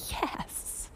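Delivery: breathless whispered yes; a tiny triumphant whisper, no voice. Retold or imitated. Whispered yes as a parking spot opens up. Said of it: "I'm like".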